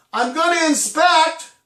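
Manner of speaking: high, loud, drawn-out warning; mom's warning call. Retold or imitated. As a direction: call it out slowly as a warning to the kid. Mother calls out her warning.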